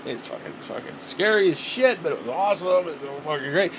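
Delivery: hammed-up thrilled spaceman; excited astronaut. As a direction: yell it like you just got back from the moon. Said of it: "you'd be talking like that".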